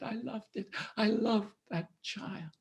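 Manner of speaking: soft broken whisper of grief; grieving whisper. Acted. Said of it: "the woman wept and wailed".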